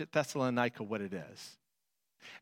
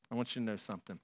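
"I want you to know something" said much softer and lower; soft repeat; 7 semitones lower, 7 dB quieter, much faster than usual. Acted. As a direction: drop to a near hush. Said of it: "repeat, second softer".

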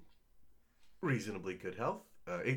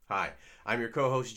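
ordinary talk

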